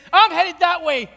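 convert's loud vow; ringing declaration. Retold or imitated. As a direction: proclaim his new path out loud. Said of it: "and he said".

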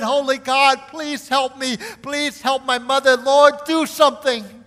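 loud, high, desperate cry to God; pleading prayer. Retold or imitated. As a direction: beg the Lord with everything you have. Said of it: "I pleaded to the Lord".

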